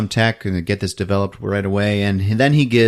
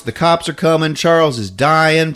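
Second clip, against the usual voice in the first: normal talking voice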